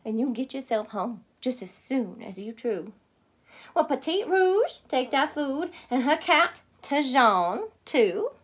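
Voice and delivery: put-on lively Cajun accent. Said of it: "my take on their accent".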